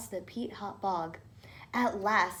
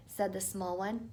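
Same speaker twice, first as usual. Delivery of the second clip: plain reading voice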